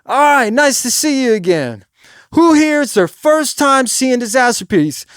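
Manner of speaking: loud, high stage address; projected voice. Shown as a demonstration. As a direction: project to the back of the room. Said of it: "You gotta project your voice".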